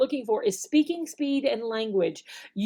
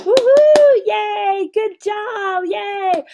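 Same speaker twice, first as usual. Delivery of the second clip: much higher, louder cheering; excited praise; 10 semitones higher, 10 dB louder, much slower than usual. Shown as a demonstration. Teacher praising a young student, excited cheering. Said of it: "giving them a praise or a compliment".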